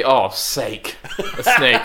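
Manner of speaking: Oh sake a snake, said through laughter; laughing pun. Shown as a demonstration. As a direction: pitch the pun while cracking up. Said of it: "You could say".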